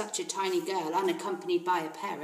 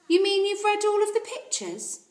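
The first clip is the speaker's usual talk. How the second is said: much higher amazed question; astonished librarian; 12 semitones higher, 6 dB louder than usual. Acted. Mrs Phelps amazed at Matilda's reading, higher voice. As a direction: sound amazed at the tiny girl.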